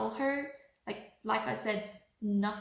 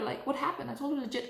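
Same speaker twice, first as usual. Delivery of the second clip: usual speaking voice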